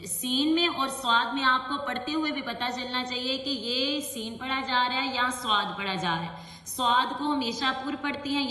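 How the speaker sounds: ordinary delivery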